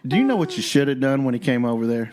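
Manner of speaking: ordinary talk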